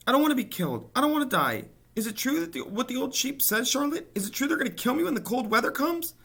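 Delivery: very high, loud panicked squeal, fast at the end; frantic panic. A narrator's reading. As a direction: plead in rising panic. Wilbur panicking to Charlotte.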